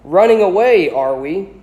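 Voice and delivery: sneaky portrait voice catching Harry leaving. A narrator's reading. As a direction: tease him slyly, like a sneering portrait. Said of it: "a sneaky voice said".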